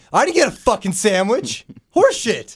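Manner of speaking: angry rant. Real, not acted. Ranting about hospital.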